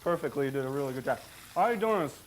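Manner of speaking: talking through a mouthful of pasta; chewing while talking; mouth full. Real, not acted. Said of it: "talking with his mouth full".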